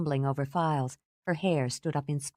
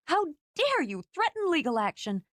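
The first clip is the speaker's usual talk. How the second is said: furious; 11 semitones higher than usual. Acted. Furious accusation.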